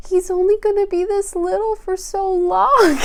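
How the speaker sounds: high, teary retelling of her nightly lament; tearful lament. Retold or imitated. Retelling her nightly baby-blues tears to viewers, high and teary. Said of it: "And I would say every time".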